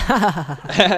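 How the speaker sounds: mock laughing. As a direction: laugh in a robber's face, hammed up. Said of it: "then you start laughing".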